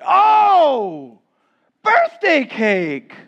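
loud, high, drawn-out mock craving; hammed-up delight. Preacher playing a dieter seeing birthday cake, loud hammed-up delight. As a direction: cry out in exaggerated temptation.

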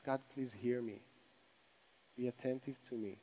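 very soft, reverent prayer; humble plea. Acted. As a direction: plead gently for God's attention. Voices psalmist's humble prayer.

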